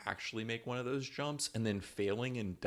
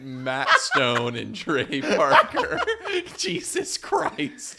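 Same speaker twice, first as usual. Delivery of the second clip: slow, high laughing correction; 4 semitones higher, 10 dB louder, much slower than usual. Real, not acted. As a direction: crack up mid-sentence. Correcting the names while laughing.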